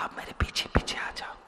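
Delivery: quick unvoiced whisper; fast whisper. Krishna whispering to the man, quick whisper. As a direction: hurry the secret instruction under your breath.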